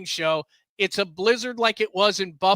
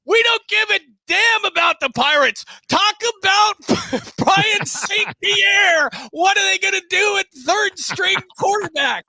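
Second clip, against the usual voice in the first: enraged caller yelling, much higher and louder; screaming caller; 13 semitones higher, 7 dB louder than usual. Retold or imitated. Pittsburgh caller screaming at the radio host, angry yell. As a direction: scream it like a furious sports fan. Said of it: "he screams at me".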